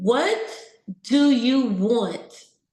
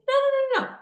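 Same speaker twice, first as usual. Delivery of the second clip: emphatic denial; faster than usual. Real, not acted. Rejecting the vain accusation.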